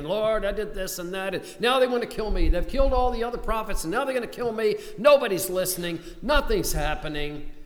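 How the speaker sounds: fast, high and loud desperate protest; Elijah's frantic complaint. Acted. Elijah pouring out his fears to God, in a fast, high, loud protest. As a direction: rush it out like a hunted man.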